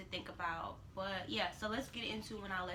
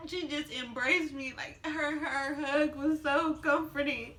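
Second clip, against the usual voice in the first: higher, tearful voice; crying; 4 semitones higher, 5 dB louder, slower than usual. Real, not acted. Mother recalling the nurse's comforting hug, crying. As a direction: struggle through it on the edge of sobs.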